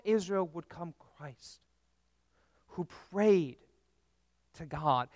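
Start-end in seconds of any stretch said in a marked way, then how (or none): none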